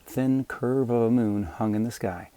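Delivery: ordinary delivery, nothing marked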